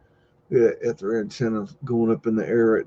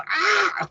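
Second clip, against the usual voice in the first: mock zebra yelp. Zebra startled cry imitated.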